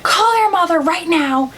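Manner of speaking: high-pitched mock-frantic mom giving orders; imitated freak-out. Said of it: "my mom was freaking out".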